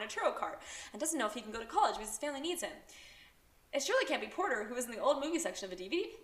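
gushing teen character impression with lively swings in pitch; animated, run-on character voice; Bailey. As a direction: rattle on as an oblivious protagonist, speeding through the long lists. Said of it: "one of my world-famous character impressions".

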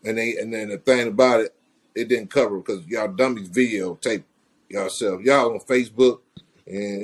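ordinary talk

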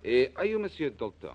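light put-on French accent; a Frenchman greeting the fence politely, lightly French-accented; Jacques Pierre French accent. Blackie posing as French buyer greeting fence, light French accent. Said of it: "I'm going to be Monsieur Jacques Pierre".

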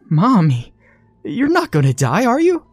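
high, rising, tearful plea; a small girl pleading with her mother; frightened little girl. Acted. Little girl frightened, lip quivering.

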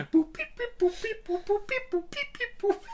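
very high beeping boops; alien voice. Pretend. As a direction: beep it out like an alien tune. Imitating Torgo's theme.

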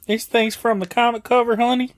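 high, loud put-on coy voice; teasing wife act. Co-host joins in as teasing Liz.

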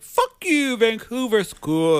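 loud, high mock Canadian accent. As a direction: yell it in a hammed-up Canadian accent. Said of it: "Canadian accent?".

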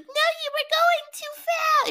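high, slow put-on whine; hammed-up high slow protest; mock Debbie. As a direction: stretch it out like a flustered lady. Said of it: "She's like".

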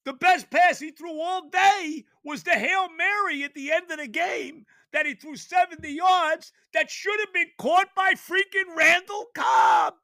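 high, loud exasperated rant; angry rant. Real, not acted. Fan venting about the missed catch, angry rant. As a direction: vent it all at once.

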